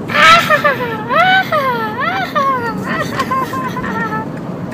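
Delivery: put-on very high squealing kid laughter; giggling like little kids. Recalling how she and her friend giggled as kids, high squeals. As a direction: shriek with childish giggles. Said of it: "all we would do was like".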